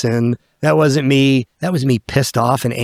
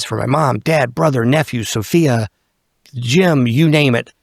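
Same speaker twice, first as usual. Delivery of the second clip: normal talking voice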